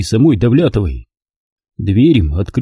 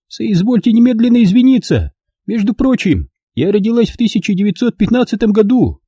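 put-on high, proud elderly noblewoman; old lady voice; 11 semitones higher than usual. A narrator's reading. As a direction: speak as a prim dignified old lady.

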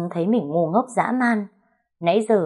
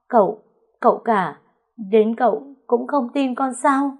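high, halting, disbelieving plea; wounded, high-pitched appeal; hurt pleading; 6 semitones higher than usual. A narrator's reading. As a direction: plead as if betrayed by your lover. Accused maid pleads with master.

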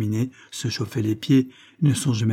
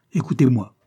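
insistent repeat, louder but less high; repeated impatiently; 3 semitones higher, 5 dB louder than usual. A narrator's reading. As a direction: say it again, firm and loud. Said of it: "répéta-t-il en se levant brusquement". (répété avec insistance, plus fort)

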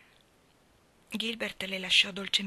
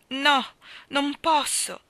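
higher and louder, despair breaking through; desperate exclamation; 6 semitones higher, 7 dB louder, much slower than usual. A narrator's reading. Anna refuses Gilbert's hope for the future, loud despairing cry. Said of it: "esclamò Anna con la disperazione nella voce".

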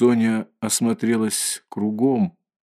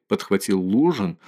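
ordinary delivery, nothing marked

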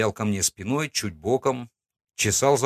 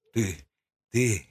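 stammering, strained rasp; hoarse wheeze; 2 semitones higher than usual. Acted. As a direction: push the words out through a dry throat. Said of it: "просипел он".

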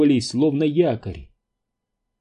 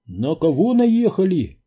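higher-pitched anxious query; agitated question; 6 semitones higher, 3 dB louder than usual. A narrator's reading. Паунд asks what they ran over, agitated. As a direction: ask it with rising worry. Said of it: "заволновался Паунд".

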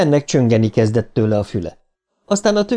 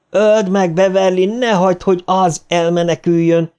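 high, frantic urging; urgent shouting; 8 semitones higher than usual. A narrator's reading. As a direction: plead and command in a high-pitched cry. Children urge Beverly to kill.

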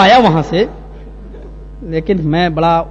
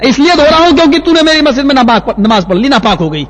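high, raised accusation; imitated indignant outburst; 6 semitones higher, 3 dB louder than usual. Mosque washer blaming the visitor, indignant outburst. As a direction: push it out in exasperation.

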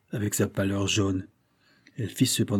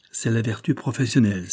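ordinary delivery, nothing marked

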